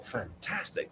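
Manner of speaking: plain reading voice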